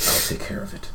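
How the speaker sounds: low, hushed reply; stone cold. Acted. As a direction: answer very quietly and coldly. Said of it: "face turns stone cold".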